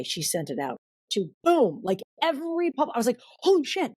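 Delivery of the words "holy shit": high, excited disbelief; retold amazement. Author seeing her proposal go out, amazed. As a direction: relive the thrill in a raised voice. Reliving shock at pitch going out.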